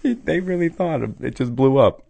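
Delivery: mock future voice. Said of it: "they're going".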